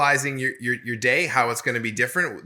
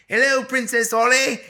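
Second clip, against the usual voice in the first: high, hammed-up bad British accent; 11 semitones higher, 5 dB louder than usual. Father playing with daughter, hammy bad British accent. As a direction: greet the princess in a terrible British accent. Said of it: "worst British accent you've ever heard".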